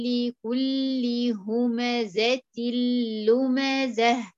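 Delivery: "لكل همزة لمزة" very slow, noon merged into the lam; drawn-out "همزة لمزة" with a doubled lam; idgham into lam. Shown as a demonstration. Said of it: "اللام الثانية المشددة". ("همزة لمزة" بالإدغام ببطء)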